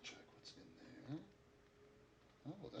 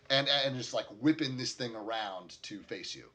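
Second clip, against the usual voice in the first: ordinary talk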